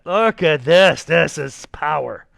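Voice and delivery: slow, loud and proud over the drawing; excited show-off. Real, not acted. Teacher showing the class a scribble, proudly loud and slow. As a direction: sell the scribble like a masterpiece to the class.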